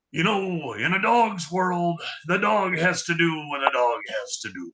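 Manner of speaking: Rolf. Shown as a demonstration. Co-host demonstrating the phrase that gets him into character, slow Rolf voice. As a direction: stretch the words out like a musing puppet dog. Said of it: "a phrase that I have to say, and then I can pop into the Rolf voice".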